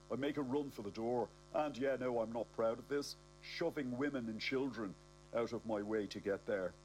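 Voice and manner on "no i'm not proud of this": usual speaking voice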